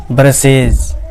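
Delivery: ब्रशेज drawn out slowly, ending in a voiced "iz". Shown as a demonstration. Teacher showing the plural of brush, slow with voiced iz ending. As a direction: say ब्रशेज slowly with a z/j sound at the end. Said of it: "जो के तरह आना".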